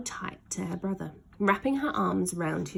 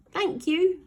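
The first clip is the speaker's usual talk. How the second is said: called back; 11 semitones higher than usual. Acted. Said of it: "he called back".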